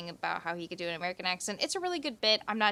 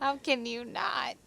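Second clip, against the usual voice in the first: higher, slow, drawn-out question; playful exclamation; 6 semitones higher than usual. Pretend. Repeats the line, then escalates it.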